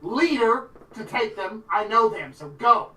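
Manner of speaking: high, insistent voice building to loud shooing; indignant Gloria arguing. Acted. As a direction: insist on taking charge, then shoo them off. Gloria argues, shoos guide away.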